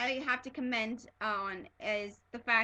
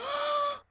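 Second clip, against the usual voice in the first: sharp high gasp of shock; 5 dB louder than usual. Retold or imitated. Fan reliving Klaus's death with a gasp. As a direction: gasp as if he's really gone. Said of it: "I was just like".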